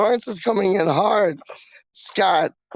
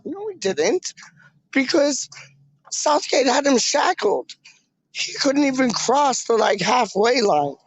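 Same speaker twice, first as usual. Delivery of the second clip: much higher, indignant protest; heated disagreement; 6 semitones higher than usual. Real, not acted. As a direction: argue back hard against the pundits. Disputing pundits about Declan Rice.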